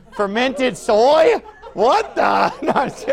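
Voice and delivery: loud, drawn-out incredulous question breaking into laughter; joking disbelief. Real, not acted. Preacher reacting to congregant's natto suggestion, laughing disbelief. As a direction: react as if baffled, then laugh.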